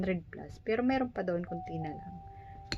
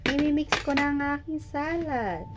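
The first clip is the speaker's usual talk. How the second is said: excited; 8 semitones higher, 6 dB louder than usual. Real, not acted. Lively cooking narration.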